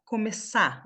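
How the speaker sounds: "começar" said as "começá"; silent final R. Shown as a demonstration. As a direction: drop the last R of "começar". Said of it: "Esse R aqui".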